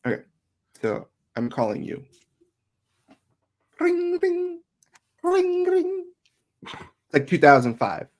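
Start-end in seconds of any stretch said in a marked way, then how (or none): 3.8-6.1 high sing-song ring ring; phone ring. Acted. Calling daughter, imitates the phone ringing in a high voice.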